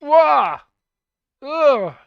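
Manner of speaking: loud, high cry, then a falling "ugh"; startled yelp and groan. Real, not acted. Trying hat samples on stream, startled yelp and groan. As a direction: recoil out loud from a horrid noise. Recoils from a nasty sound.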